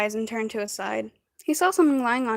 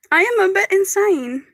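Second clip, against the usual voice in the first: excited; 8 semitones higher, 5 dB louder than usual. Real, not acted. Giddy outburst.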